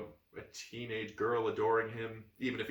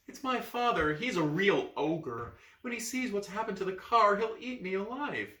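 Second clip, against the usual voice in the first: put-on girlish worry; 8 semitones higher than usual. Girl pleading.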